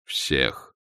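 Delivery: low, soft one-word answer; terse and deep, as Death; Смерть. A narrator's reading. Смерть отвечает демону. (низкий голос Смерти)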